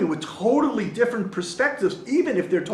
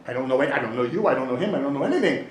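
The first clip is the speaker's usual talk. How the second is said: low, hesitant protest in short repeats; reluctant rabbi; 4 semitones lower, faster than usual. Acted. Rabbi asked to eulogize a stranger, low reluctant protest. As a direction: beg off, at a loss.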